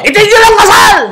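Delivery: high, loud wedding-stopping yell; sudden shout. Acted. Guest interrupts the vows, acted sudden shout. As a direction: shout it out like a dramatic objector. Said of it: "Paano kung biglang sumigaw".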